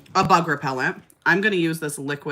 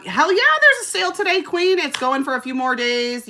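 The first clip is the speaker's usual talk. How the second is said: high, loud burst of hype; excited; 11 semitones higher, 4 dB louder than usual. Real, not acted. Telling a viewer there's a sale today, excited. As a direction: cheer it out, pitched way up.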